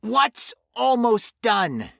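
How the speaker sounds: high, loud, slow panicked question; terrified man crying out to a stranger; frightened cry. A narrator's reading. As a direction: demand it in terror. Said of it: "Lenny bawled".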